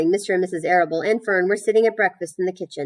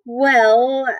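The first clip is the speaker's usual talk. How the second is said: higher, louder, drawn-out start; thoughtful; 6 semitones higher, 6 dB louder than usual. A narrator's reading. Fern considers what Charlotte looks like, thoughtful. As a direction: stretch the word out while thinking. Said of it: "said Fern thoughtfully".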